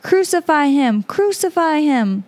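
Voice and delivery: lightly voiced outcry in a Bible reading; crying out. A narrator's reading. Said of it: "they cried out".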